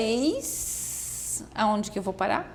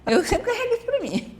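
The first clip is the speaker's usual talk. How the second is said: laughing; 12 semitones higher, 4 dB louder than usual. Real, not acted. Laughing at her own joke.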